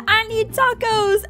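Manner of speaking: high, stretched-out friend voice; voicing Riley. Friend asking for tacos, high drawn-out voice. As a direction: pitch it way up and draw it out. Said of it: "She said".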